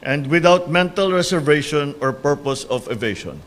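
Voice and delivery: solemn, measured oath-taking; court oath. Acted. Administers the oath of office.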